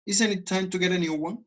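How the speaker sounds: "Isn't it time to get a new one?" rising high at the end; rising question tune. Shown as a demonstration. Teacher demonstrating question intonation to students. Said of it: "Y miren la entonación".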